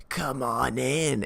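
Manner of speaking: slow, eerie invitation from the dark doorway; creepy beckoning woman. Retold or imitated. Woman in the abyss beckons Cale, slow and eerie. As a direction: lure them in slowly and creepily.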